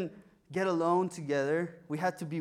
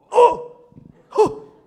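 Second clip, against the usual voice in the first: loud delighted crowd oh; audience exclaims; 15 dB louder than usual. Real, not acted. Listeners hear she loved him first, loud delighted oh. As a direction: burst out in joy.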